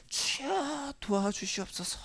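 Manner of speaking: imitated plea to the Lord, high and soft on the opening cry; pleading prayer. Preacher mimics someone praying for their own sake, pleading softly. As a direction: draw out a hushed mock prayer. Acting out a self-serving prayer.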